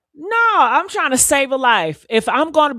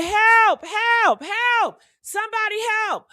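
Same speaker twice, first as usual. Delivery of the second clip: mock cry for help; 9 semitones higher than usual. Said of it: "I would say".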